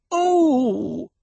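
high, indignant "oh!"; huffy high-pitched wail of frustration; exasperated cry. A narrator's reading. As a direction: let out an affronted cry. Hera's thwarted outburst after rebuke.

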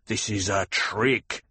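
slow accusing snarl. A narrator's reading. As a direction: snarl it slowly, word by word. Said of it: "Gurney snarled".

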